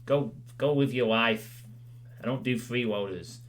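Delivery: ordinary talk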